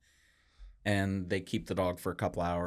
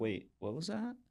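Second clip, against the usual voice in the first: high startled question; 9 semitones higher, 5 dB quieter than usual. Retold or imitated. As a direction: react to a sudden growl. Startled by snarling dog.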